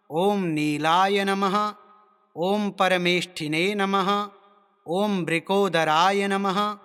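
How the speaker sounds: slow, sustained chanting of name mantras; mantra recitation. Shown as a demonstration. Teacher reciting Yama's names for learners, slow sustained chant. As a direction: intone each name evenly with drawn-out syllables. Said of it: "तो चलिए इन मंत्रों को हम बोलते हैं".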